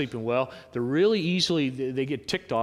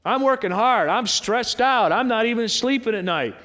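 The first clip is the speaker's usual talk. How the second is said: louder, higher mock complaint; stressed-out worker; 7 semitones higher, 5 dB louder than usual. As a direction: play an overworked person venting.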